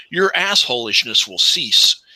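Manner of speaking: ordinary talk, nothing marked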